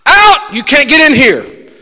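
high, loud refusal shout. Acted. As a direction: bar the door with a yell. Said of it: "you look at that circumstance and say".

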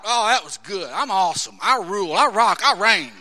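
loud, cocky self-praise; mock bragging. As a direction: strut like you rule the world.

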